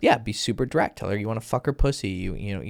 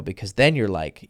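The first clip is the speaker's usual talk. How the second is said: normal talking voice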